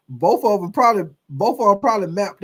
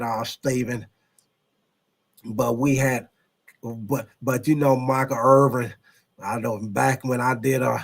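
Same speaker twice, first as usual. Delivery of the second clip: Jerry Jones impression; 5 semitones lower than usual. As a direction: explain it calmly like an old Texas owner. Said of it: "a very good Jerry impression".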